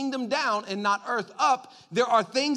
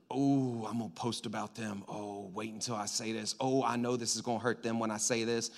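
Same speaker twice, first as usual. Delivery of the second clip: scheming murmur; 9 semitones lower, 7 dB quieter than usual. Acted. Scheming person voiced.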